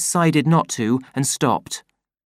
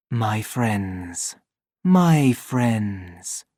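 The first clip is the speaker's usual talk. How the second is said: gentle sorrow; 3 semitones lower, much slower than usual. Acted. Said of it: "with a note of such gentle sorrow".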